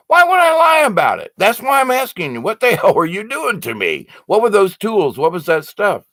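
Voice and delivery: the patient pushing the doctor with worked-up questions; insistent excited demand. Retold or imitated. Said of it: "And I'm like".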